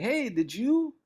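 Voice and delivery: lightly voiced friend asking a favour; a hint of an upbeat comedian; friend's offer. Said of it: "he's like".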